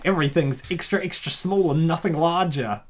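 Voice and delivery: ordinary delivery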